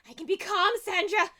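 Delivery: high, loud insistence on being calm; agitated goblin yell; losing composure. Acted. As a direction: burst out loudly while claiming calm.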